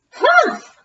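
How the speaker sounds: high, sharp huff; scoffing witch. Acted. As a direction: scoff at him.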